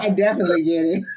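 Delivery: fast, through real laughter; quick real laughing agreement. Real, not acted. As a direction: spill it out while laughing. Laughing at the inside joke.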